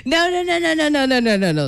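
rapid high-pitched string of no's, sliding down; flustered self-interruption. Real, not acted. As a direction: cut yourself off with a high, tumbling protest. Cutting off own failed accent attempt.

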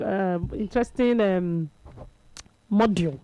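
ordinary talk, nothing marked

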